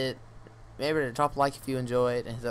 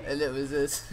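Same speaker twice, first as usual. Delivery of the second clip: ordinary talk, nothing marked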